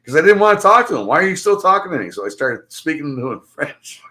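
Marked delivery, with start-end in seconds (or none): 1.1-2.1 quick, high-pitched exasperation; exasperated retort. Retold or imitated. Replaying his brush-off of the stranger, exasperated. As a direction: fire off an exasperated question, pitched up.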